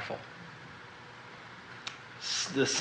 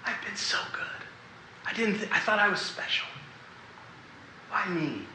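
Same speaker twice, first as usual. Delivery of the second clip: higher, soft, voiceless sobbing plea; retold sobbing, higher and much softer; weeping; 5 semitones higher, 10 dB quieter than usual. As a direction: cry out to God softly through tears. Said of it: "and just weeping".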